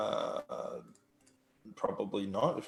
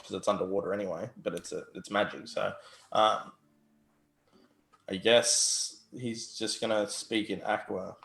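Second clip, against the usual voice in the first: normal talking voice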